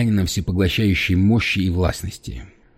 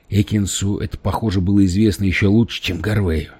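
ordinary delivery, nothing marked

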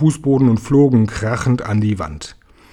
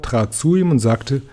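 ordinary delivery, nothing marked

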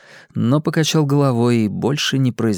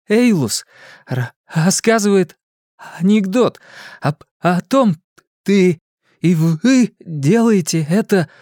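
put-on stuttering boy; 7 semitones higher than usual. Bill stutters explaining.

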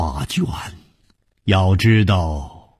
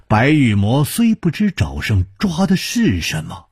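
usual speaking voice

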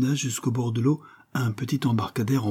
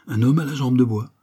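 lightly surprised, a little louder; pleasant surprise; 4 semitones higher, 5 dB louder than usual. Acted. Holmes to Mrs Smith, mild pleased surprise. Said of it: "en éprouvant une agréable surprise".

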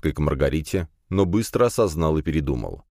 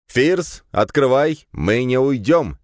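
raised, higher voice, demanding; disgruntled shouting; 7 semitones higher, 4 dB louder, slower than usual. A narrator's reading. Classmate demanding that Firs open the door, disgruntled shouting. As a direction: call out crossly as if through a door. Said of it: "раздался недовольный голос Вешкина".

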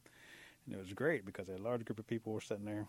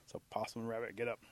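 plain reading voice

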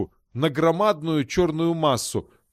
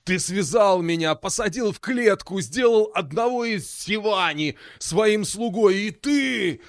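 rising, furious demon shout; 5 semitones higher than usual. Acted. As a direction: build to a roar. Demon rages at captor.

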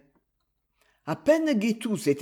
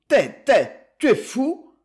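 louder and higher, shocked protest; outraged outburst; 6 semitones higher, 6 dB louder than usual. A narrator's reading. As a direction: burst out at the absurd idea. Garrigue outraged at the proposal.

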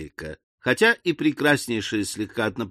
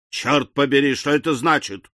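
plain reading voice

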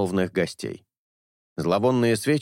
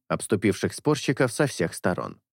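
usual speaking voice

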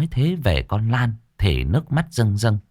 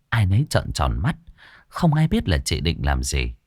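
usual speaking voice

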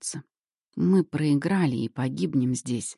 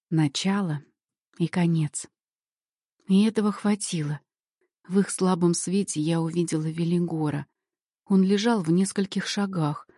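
usual speaking voice

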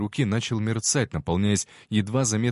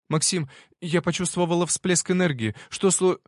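put-on higher, anxious girl's voice; worried young woman rushing in; 9 semitones higher than usual. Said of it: "Лицо девушки было встревоженным".

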